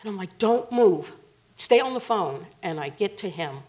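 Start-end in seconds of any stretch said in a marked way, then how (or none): 0.4-2.5 firm, louder and higher command; raised-voice urgency, reenacted; urgent order. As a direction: order him to stay put. Retold urgent order to him.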